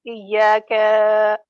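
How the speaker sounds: Iyaka with the final ka dragged out; wrongly prolonged ending. Retold or imitated. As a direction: hold the last vowel of Iyaka too long. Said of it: "The mistake which comes here".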